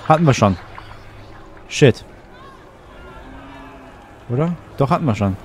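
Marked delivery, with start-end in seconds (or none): none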